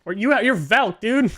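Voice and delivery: loud, high, incredulous reminder; excited reminder. Real, not acted. Player reminding a teammate of their character, high excited shout.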